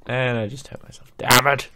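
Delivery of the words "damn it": sharp annoyed curse; frustrated outburst. Real, not acted. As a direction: snap it out loud. Just hurt himself in game.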